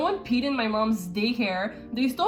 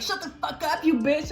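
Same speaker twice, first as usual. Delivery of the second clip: mock yell; 5 semitones higher than usual. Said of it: "yelling at her".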